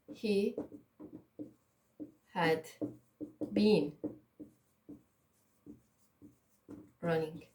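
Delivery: very slow, long silences between words; word-by-word dictation. Shown as a demonstration. Teacher writing the past example, dictating word by word. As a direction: say each word separately as you write it.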